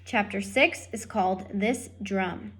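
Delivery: usual speaking voice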